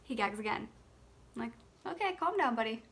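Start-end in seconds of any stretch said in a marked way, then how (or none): 1.9-2.8 put-on calming baby. Mom calming gagging baby.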